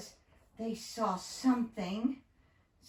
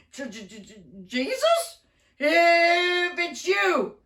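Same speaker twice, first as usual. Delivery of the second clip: stammering fear; 7 semitones higher, 6 dB louder than usual. Acted. Peter calls out stammering.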